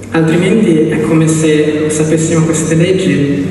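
usual speaking voice

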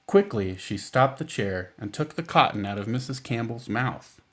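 ordinary delivery, nothing marked

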